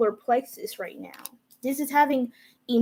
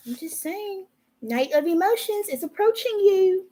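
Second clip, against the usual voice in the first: odd high voice; 5 semitones higher than usual. Real, not acted. Tarot reader telling Capricorns their card, in an odd high voice. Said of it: "why am I speaking like this?".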